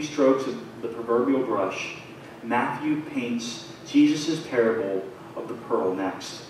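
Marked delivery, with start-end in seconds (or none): none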